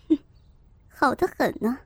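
cold sneer. Acted. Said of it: "周慧敏冷笑着说".

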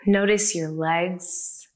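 usual speaking voice

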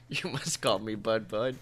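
put-on low mopey kid voice; imitating Bud Bud. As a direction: drone it like a deadpan teen. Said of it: "he's just like".

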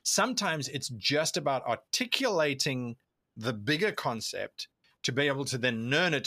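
ordinary talk, nothing marked

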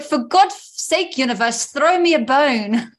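imitated posh plea, drawn out. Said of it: "in such a posh voice".

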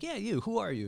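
pointing someone out. Retold or imitated. Singling out audience member.

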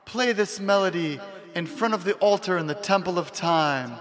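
high, gentle princess voice. Acted. Voicing Zelda's message.

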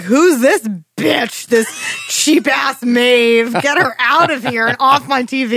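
hammed-up fury at a replacement character; mock outraged yell. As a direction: drag it out like an offended fan yelling at the TV. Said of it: "and I'm like".

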